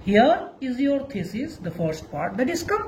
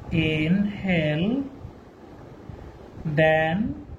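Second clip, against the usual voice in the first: slow, deep, deliberate recitation with long pauses; solemn quotation read aloud; slow dictation; 7 semitones lower, 4 dB quieter, slower than usual. Shown as a demonstration. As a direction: read the famous line out slowly, word by word. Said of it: "like this".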